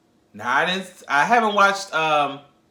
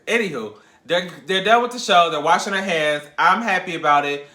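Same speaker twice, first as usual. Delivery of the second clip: ordinary talk, nothing marked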